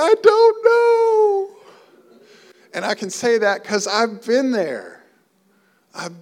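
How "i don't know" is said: high, drawn-out tearful wail; mock crying. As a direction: imitate someone sobbing at the altar. Crying worshipper imitated.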